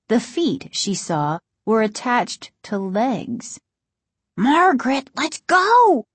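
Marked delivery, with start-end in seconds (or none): 4.4-6.0 high, urgent plea; pleading. A narrator's reading. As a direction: plead loudly to get away. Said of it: "Casey pleaded".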